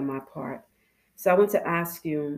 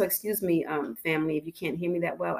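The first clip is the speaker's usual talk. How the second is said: ordinary talk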